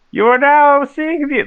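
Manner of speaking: high, loud herald call; town crier voice. Acted. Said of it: "my town crier voice".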